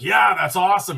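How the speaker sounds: buddy loving the name, excited; excited approval. Retold or imitated. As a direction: burst out with delight at the name. Friend approves the podcast name.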